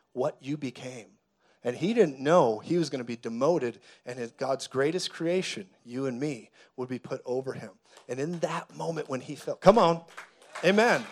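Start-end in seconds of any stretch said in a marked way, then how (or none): none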